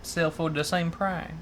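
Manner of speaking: slow mock Vietnamese accent. Co-host guessing at prices as punishment, Vietnamese accent. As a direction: speak slowly in a put-on Vietnamese accent. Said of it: "I will do a Vietnamese accent".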